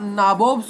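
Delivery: "नाबोब्स" with long "naa" and rounded "bobs"; mispronounced nawab. Shown as a demonstration. Teacher showing the wrong-way reading, mispronounced "नाबोब्स". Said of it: "wrong way में पढ़ते हो".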